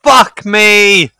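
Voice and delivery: high, loud curse; exasperated outcry. Real, not acted. Streamer crying out at an annoying chat message. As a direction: throw it out, exasperated.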